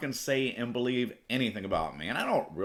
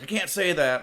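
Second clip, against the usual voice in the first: officer protesting; 7 semitones higher, 5 dB louder than usual. Retold or imitated. Officer refuses to apologize.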